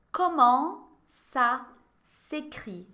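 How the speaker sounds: "Comment ça s'écrit?" stretched out very slowly, each syllable apart; very slow model. Shown as a demonstration. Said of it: "Répétez". ("Comment ça s'écrit?" bem devagar, sílaba por sílaba)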